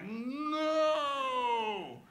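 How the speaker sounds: drawn-out high cry of alarm; slow-motion no. Retold or imitated. Dad seeing his toddler fall, drawn-out slow-motion cry. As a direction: stretch it like a slow-motion movie yell. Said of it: "everything goes in slow motion".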